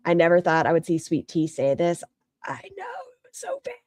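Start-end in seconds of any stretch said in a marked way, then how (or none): 2.4-3.7 breathy high whisper. Real, not acted. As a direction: whisper it in shocked disbelief. Shocked aside after clip.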